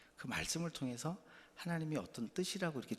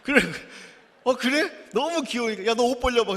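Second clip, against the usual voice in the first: much higher and louder, delighted shopkeeper; 신난 아저씨; 9 semitones higher, 12 dB louder than usual. Retold or imitated. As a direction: burst out with a generous grown-up's joy. 아저씨가 기뻐하며 말함. (훨씬 높고 크게 기뻐하는 아저씨 목소리)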